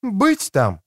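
high, firm woman's reply; firm female character voice. A narrator's reading. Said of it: "твёрдо ответила она".

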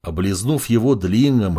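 ordinary delivery, nothing marked

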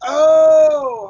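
loud excited oh; excited shout. Real, not acted. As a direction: burst out in delight. Hype shout at fan art.

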